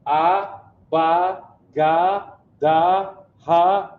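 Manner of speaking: AH, BA, GA, DA, HA one by one, all ending in a rising ah; open ah sound. Shown as a demonstration. Teacher drilling the ah sound, each syllable separate and open. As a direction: chant each of AH, BA, GA, DA, HA separately and firmly. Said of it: "That's that ah sound you keep hearing".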